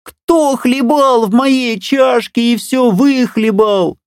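very high, slow squeaky bear cub; thin squeaky voice. A narrator's reading. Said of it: "запищал тоненьким голосом". (тоненький писклявый голосок медвежонка)

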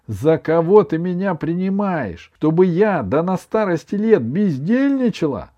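high, loud protest; indignant. A narrator's reading. Grandmother refusing to idle in old age, indignant. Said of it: "возмутилась бабушка".

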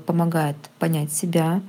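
ordinary talk

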